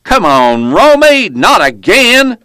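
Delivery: high, loud holler from a truck window; exasperated yell. A narrator's reading. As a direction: yell it out, stretching the last words. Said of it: "The driver leaned out and yelled".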